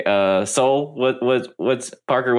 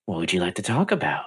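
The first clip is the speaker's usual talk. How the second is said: softer, lower amused captive; quiet smiling NPC; 5 semitones lower, 6 dB quieter than usual. Acted. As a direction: ask it quietly with a smile. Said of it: "smiles at you at the moment going".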